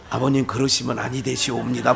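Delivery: lightly voiced formal son-in-law; a hint of an old-fashioned deferential son-in-law; polite son-in-law. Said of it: "뭐 이렇게 할 때가 있어".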